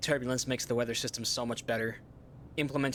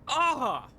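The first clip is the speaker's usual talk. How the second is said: frustrated yell; 12 semitones higher, 4 dB louder than usual. Real, not acted. End of outburst.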